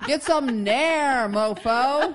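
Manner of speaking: loud, slow, laughing put-down; laughing jab. Acted. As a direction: bark it out through a laugh. Joking insult delivered over the top.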